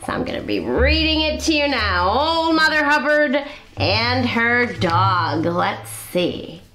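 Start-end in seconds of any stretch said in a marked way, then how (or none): none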